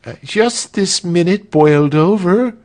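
high, loud reply through a door; servant calling back. A narrator's reading. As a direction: shout back from the next room. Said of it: "voice from the other side".

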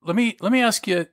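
normal talking voice

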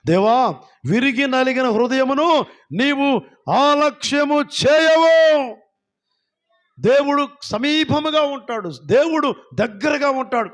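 high, slow, drawn-out scripture cry; full-force preaching. Real, not acted. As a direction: stretch the final word into a loud, high peak. (గొంతెత్తి ప్రసంగిస్తూ)

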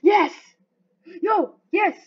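loud, high bursts; excited shouting. Real, not acted. Gamer reacting to risky jumps, excited shouts. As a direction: shout each word as it happens.

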